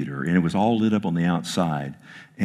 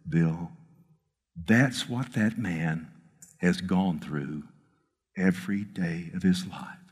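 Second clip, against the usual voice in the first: soft voice of God; 4 semitones lower, 8 dB quieter, slower than usual. Retold or imitated. Voicing the Lord softly.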